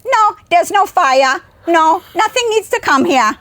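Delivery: high, loud German grandmother, slowed down; German accent. Retold or imitated. Grandmother calling off the 911 dispatcher, German accent. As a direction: insist firmly in an imitated German accent. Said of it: "German accent".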